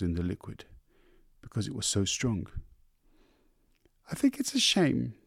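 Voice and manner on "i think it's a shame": sympathetic wife. A narrator's reading.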